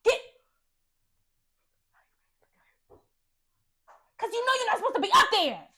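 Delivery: loud, high-pitched telling-off; real scolding shout. Real, not acted. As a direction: yell at a misbehaving pet. Breaks off reading to scold pet.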